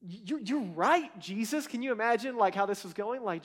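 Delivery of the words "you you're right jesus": imitated slow, stammering amazement; drawn-out, dawning realization; overwhelmed, excited. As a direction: act a man floored by what he just heard. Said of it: "probably overwhelmed and excited on the inside".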